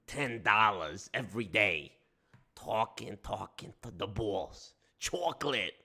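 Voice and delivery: put-on lightly held New York wiseguy accent; a hint of Brooklyn mobster, grumbling. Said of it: "the New York accent".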